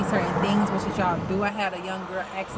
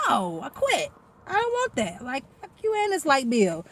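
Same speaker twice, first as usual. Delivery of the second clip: mock defiant quitting; 5 semitones higher than usual. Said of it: "it's like".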